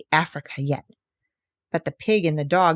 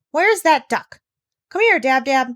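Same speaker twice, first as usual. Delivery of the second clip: high, loud call for the duck; 6 semitones higher, 5 dB louder than usual. Acted. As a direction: shout across the deck. Calling the duck.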